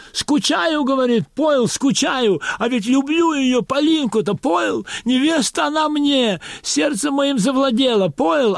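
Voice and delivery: high, hurried gabble; rushing, swallowing words. Acted. Nervous convict actor gabbling to Dzerzhinsky, high and hurried. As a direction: rush it, gulping the words. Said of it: "Геша заговорил быстрее, потом ещё быстрее".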